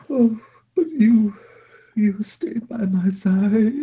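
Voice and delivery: high, slow, drawn-out lament; guilt-ridden master, tearful. Acted. Master to the goblin who stayed, high tearful wail. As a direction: wail it slowly to a loyal servant.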